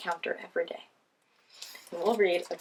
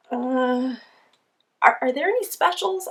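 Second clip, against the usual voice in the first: put-on timid kid voice; 5 semitones higher, 7 dB louder than usual. Kid asks the lion.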